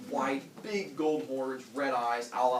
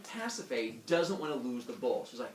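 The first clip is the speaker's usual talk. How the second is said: ordinary delivery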